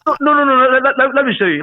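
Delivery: quick high insistent no's; eager protest. Real, not acted. Cutting in on the host to explain, high and insistent. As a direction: jump in before he finishes.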